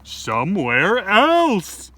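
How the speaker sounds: slow, loud and drawn out; insistent. Acted. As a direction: draw it out loudly. Pig insists loudly.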